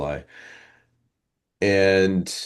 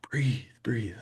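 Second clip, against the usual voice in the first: wife whispering to calm him down; 2 semitones higher, 7 dB quieter than usual. Retold or imitated.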